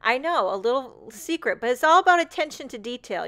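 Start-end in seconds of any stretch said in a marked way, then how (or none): none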